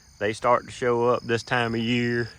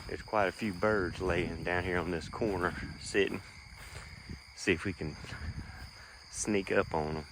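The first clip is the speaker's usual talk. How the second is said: hushed, sneaking up on birds; quiet; 4 semitones lower, 10 dB quieter than usual. Real, not acted. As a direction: keep it low so the birds stay. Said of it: "kinda quiet".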